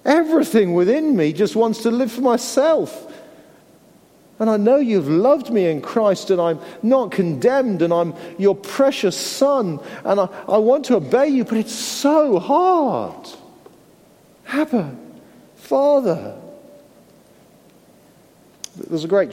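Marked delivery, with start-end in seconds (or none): 0.0-13.3 higher and pressing, slowing on "so hard"; pleading prayer. Acted. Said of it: "we cry out". 14.5-16.3 higher, aching call of Abba Father; anguished cry. Acted. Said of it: "we cry out".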